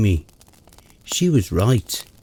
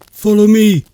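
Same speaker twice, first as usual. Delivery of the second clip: shaky lead; 8 semitones higher, 7 dB louder than usual. Acted. Shaken friend leads to body.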